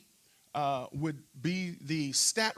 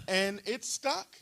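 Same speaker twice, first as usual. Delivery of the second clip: usual speaking voice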